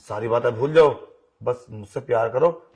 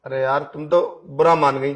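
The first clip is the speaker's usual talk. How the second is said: normal talking voice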